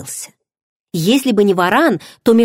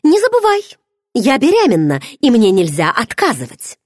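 much higher, firm snap back; curt retort; 6 semitones higher, 3 dB louder than usual. A narrator's reading. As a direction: cut her off, pitched high and final. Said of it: "отрезала она". (отрезала, резко и высоко)

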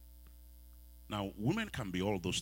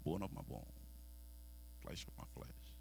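quiet; 3 semitones lower, 10 dB quieter than usual. Acted. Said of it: "the first love song".

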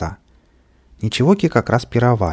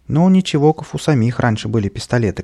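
usual speaking voice

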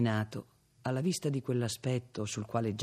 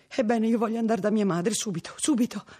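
urgent insistence; 9 semitones higher, 5 dB louder than usual. A narrator's reading. Girl insists on leaving.

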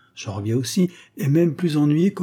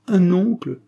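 high, louder cry of joy; delighted cry; 8 semitones higher, 5 dB louder than usual. A narrator's reading. Girl claps her hands, crying out with delight. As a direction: burst out with glee at the news. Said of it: "s'écria-t-elle".

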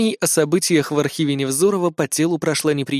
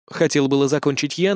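plain reading voice

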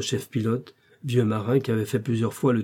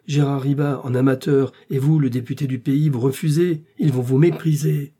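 louder, building to a higher pitch; scornful reproach; 3 semitones higher, 5 dB louder than usual. Acted. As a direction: shame him into going. Shaming him into joining the rescue.

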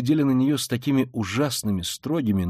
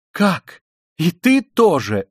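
higher, loud indignant challenge; outraged disbelief; 5 semitones higher, 6 dB louder, much slower than usual. A narrator's reading. Katerina Ivanovna spotting the landlady, outraged challenge. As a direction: round on another traitor.

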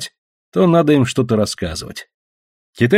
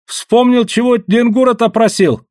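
high, excited cry of remembering; eager outburst, high all the way through; excited exclamation; 11 semitones higher, 4 dB louder, slower than usual. A narrator's reading. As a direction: blurt it out, pitched way up. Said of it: "вдруг воскликнул Чумбока".

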